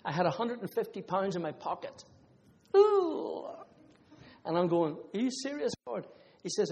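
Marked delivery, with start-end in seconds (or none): 2.8-3.4 very high, loud, startled "ooh"; a shocked squeal at the size of the gift; amazed ooh. Retold or imitated. Reacting to God's demand. 5.1-6.1 high, disbelieving "are you serious"; incredulous question. Retold or imitated. Questioning God, retold.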